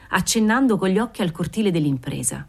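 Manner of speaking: usual speaking voice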